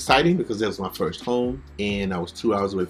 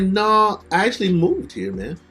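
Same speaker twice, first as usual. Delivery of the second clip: higher, louder; proud; 9 semitones higher, 4 dB louder than usual. Retold or imitated. Telling the fan he moved, proud louder reply. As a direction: announce it proudly.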